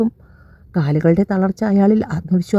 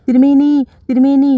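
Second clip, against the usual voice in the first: high, loud panicked calling out; frightened cry; 7 semitones higher, 3 dB louder than usual. A narrator's reading. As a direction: call for help in terror. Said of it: "Sita നിലവിളിച്ചുകൊണ്ട്". (ഭയന്ന് നിലവിളിക്കുന്നു)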